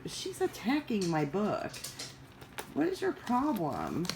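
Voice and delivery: high, startled scolding of the cat; cat lover caught off guard; exasperated at cat. Real, not acted. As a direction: shoo the cat off with a squeak of disbelief. Scolding her cat mid-video.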